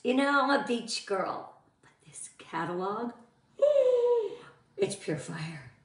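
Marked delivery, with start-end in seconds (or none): 3.6-4.3 sharp high gasp of delight; excited gasp. Real, not acted. Host gasps at the new spring catalog, high excited gasp. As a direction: gasp like you just saw the catalog.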